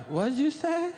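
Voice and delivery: high squeaky little voice for the dick; talking dick. Acted. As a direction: play the shy little penis, squeak it out. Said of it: "so my dick is like".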